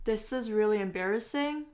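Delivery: "embarrassing" dips, then rises at the end; fall-rise tune. Shown as a demonstration. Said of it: "它用的是先降后升这样子".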